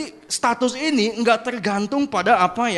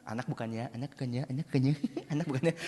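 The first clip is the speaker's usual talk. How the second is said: much softer, low, rapid mumbling; quick mock mumble, barely voiced; muttered doubting; 9 semitones lower, 13 dB quieter, much faster than usual. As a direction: mutter it over and over under your breath.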